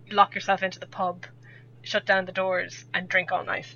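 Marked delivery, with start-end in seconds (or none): none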